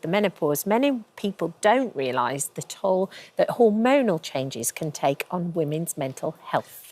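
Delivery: measured, scripted news-feature introduction; TV presenter link. Acted. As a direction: read a serious topic intro, dropping low at phrase ends. Presenter reads segment introduction.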